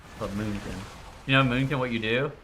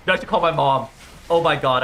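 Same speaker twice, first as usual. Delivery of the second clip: louder, eager announcement; excited realization; 6 dB louder than usual. Real, not acted. Streamer deciding to phone his mom, excited. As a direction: blurt out the great idea.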